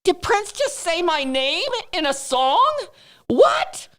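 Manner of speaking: stunned disbelief. Retold or imitated. Fan retelling hearing her name in a song, high-pitched disbelief. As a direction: build to a high, astonished squeak.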